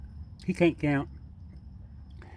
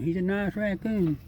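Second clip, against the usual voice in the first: high sweet pet voice; 7 semitones higher than usual. Real, not acted. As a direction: coo at the raccoon. Talking sweetly to raccoon.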